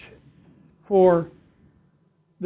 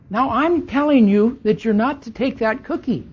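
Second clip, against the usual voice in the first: a light, higher mock scold of a mother; playfully wag a finger like a mom; 5 semitones higher, 5 dB louder than usual. Preacher imitating a mother scolding a child over a cookie. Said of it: "That word sternly is not like".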